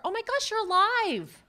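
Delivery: high, loud shouted greeting across a bar; yelled greeting. Retold or imitated. Colleague yelling across the bar in a loud high greeting. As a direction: holler it across a crowded bar. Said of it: "Yelled across the bar".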